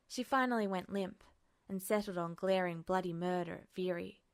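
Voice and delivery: usual speaking voice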